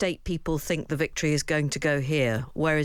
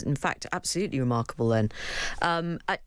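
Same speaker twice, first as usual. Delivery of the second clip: ordinary talk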